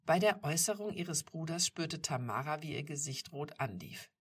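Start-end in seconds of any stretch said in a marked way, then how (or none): none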